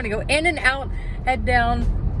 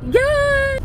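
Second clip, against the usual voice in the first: excited cheer; 6 dB louder than usual. Real, not acted. Cheering in the car.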